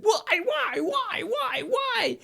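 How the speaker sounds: very high shrieking why why; despairing wails. Retold or imitated. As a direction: collapse on the floor wailing. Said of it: "is like".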